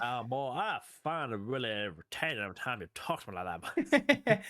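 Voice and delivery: exaggerated Southern accent, teasing the other speaker. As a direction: ham up a Southern gentleman accent, drawn out. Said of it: "a southern accent the entire time".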